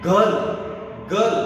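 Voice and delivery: गल said with no r; correct pronunciation. Shown as a demonstration. Teacher giving the phonetic pronunciation of girl. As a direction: say गल, r silent. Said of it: "correct phonetic pronunciation है".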